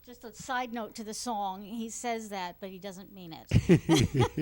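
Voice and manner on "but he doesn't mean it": laughing. Real, not acted. Teasing Phil about the song.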